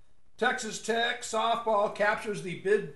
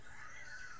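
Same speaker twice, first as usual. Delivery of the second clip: stifled laugh; 17 dB quieter than usual. Real, not acted. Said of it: "I can't even say it with a straight face".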